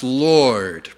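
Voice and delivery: "Lord" low, with a long hard R; stretched-out R. Shown as a demonstration. Explaining the Canadian R, "Lord" stretched out. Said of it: "is stretched out".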